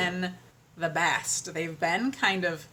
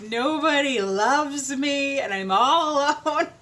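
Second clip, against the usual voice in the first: slow, higher, louder mock-whiny wail; mock self-pity; 6 semitones higher, 6 dB louder, slower than usual. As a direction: ham up feeling sorry for yourself, laughing through it. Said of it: "but I'm like".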